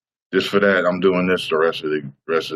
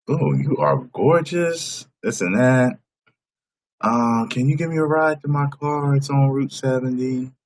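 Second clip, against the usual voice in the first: pleading woman voice; 6 semitones higher than usual. Retold or imitated. Voicing homeless woman.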